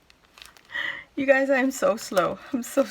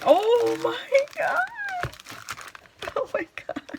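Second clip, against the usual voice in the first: excited squeal; 13 semitones higher than usual. Real, not acted. Opening a friend's gift, squealing with delight. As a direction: shriek with joy at the gift.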